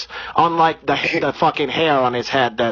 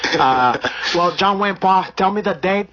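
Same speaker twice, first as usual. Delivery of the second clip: put-on Brazilian accent; 2 semitones higher than usual. Comedian holding a put-on Brazilian accent.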